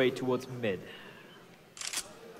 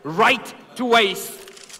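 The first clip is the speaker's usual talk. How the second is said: shouting hype caster call; esports caster; 8 semitones higher, 5 dB louder than usual. Acted. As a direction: build to a roaring clutch call. Ace clutch play-by-play.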